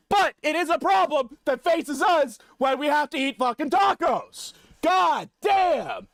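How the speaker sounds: a hammed-up rant building to a high, loud yell; mock rant shout. Streamer putting on a fake-furious taco rant to chat, growing to a high, loud shout. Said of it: "the shouting portion has begun".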